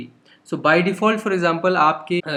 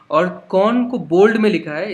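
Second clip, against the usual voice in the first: normal talking voice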